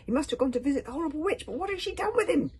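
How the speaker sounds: high, urgent panic; shrieking thoughts. A narrator's reading. Hiccup frantic inside about his missing father, shrieking. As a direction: let alarm climb to a squeal. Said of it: "thoughts shrieking".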